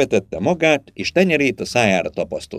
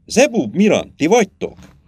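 higher-pitched searching call; 8 semitones higher, 3 dB louder than usual. A narrator's reading. Girl in the water calls to her companions, calling out.

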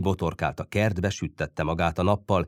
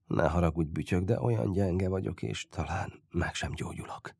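soft, fading to a whisper; weak apology, voice giving out on the verge of tears; close to tears; 7 dB quieter than usual. A narrator's reading. Sick boy apologising to his friend, nearly crying. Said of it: "mert elsírta volna magát".

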